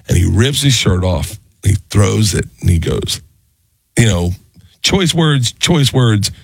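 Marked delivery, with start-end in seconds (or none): none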